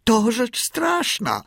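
high, drawn-out cry of dismay; shrill-pitched horrified outcry; shriek. A narrator's reading. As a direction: wail it out high and slow. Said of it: "wrzasnął Kotik".

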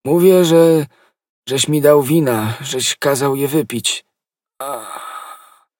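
high, halting dying voice with faint moans; agonised accusation. A narrator's reading. Dying soldier accuses the man who gave him wine, agonised. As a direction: force out each phrase between moans of pain.

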